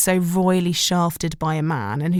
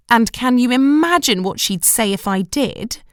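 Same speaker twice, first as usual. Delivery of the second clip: indignant; 5 semitones higher, 4 dB louder than usual. A narrator's reading. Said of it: "lips quivered with indignation".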